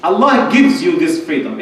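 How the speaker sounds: normal talking voice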